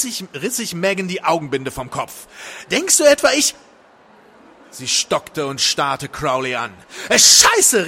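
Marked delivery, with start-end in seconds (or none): 2.7-3.5 high, loud indignant question; indignant, breaking off abruptly; outraged outburst. A narrator's reading. Megan to Crowley after tearing off blindfold, outraged. 7.1-7.9 high, loud curse; alarmed cry. A narrator's reading. Woman jumping up from Crowley, alarmed cry. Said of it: "rief sie".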